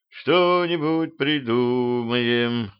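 slow, stretched words; drawn out. Acted. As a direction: drag each word out slowly. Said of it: "протянул дальше я".

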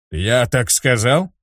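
slow, doubtful surprise; puzzled, slowly; surprised. A narrator's reading. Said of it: "удивился Ярый". (удивлённо и медленно)

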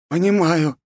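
high-pitched, meek concession; backing down. A narrator's reading. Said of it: "пошёл на попятную Святоша". (идя на попятную, высоким голосом)

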